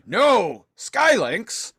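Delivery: high, loud hammed-up wail of the name; mock anguished cry. High loud mock wail. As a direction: Megatron mockingly crying out a friend's name. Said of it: "And Megatron is like".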